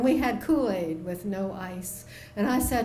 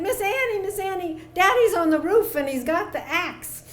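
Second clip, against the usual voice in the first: urgent calling out; 8 semitones higher, 6 dB louder than usual. Retold or imitated. Girl rushing in calling for help.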